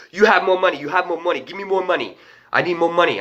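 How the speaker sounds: put-on pushy driver with rough African accent; demanding African-accented cabbie. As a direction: press for cash in an imitated African accent. Cab driver demands money, retold.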